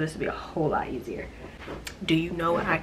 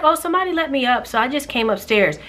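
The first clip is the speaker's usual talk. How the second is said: mimicking shopper; 6 semitones higher than usual. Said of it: "He's like".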